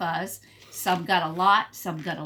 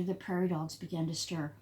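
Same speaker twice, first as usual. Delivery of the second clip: usual speaking voice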